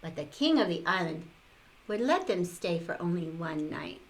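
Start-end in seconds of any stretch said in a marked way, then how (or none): none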